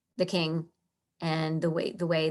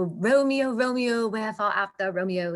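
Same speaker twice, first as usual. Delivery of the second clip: mock Shakespearean British declaiming; posh British stage actor, hammed-up; sophisticated British accent. Shown as a demonstration. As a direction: swoon grandly in a put-on British accent. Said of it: "very, very sophisticated British accent".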